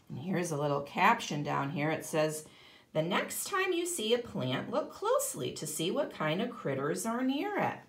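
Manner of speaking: ordinary delivery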